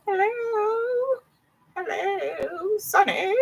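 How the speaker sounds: put-on high, wavering old granny calling out; quavery old granny calling for Sonny; a creaky high granny voice, searching for someone. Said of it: "My granny voice just go with it".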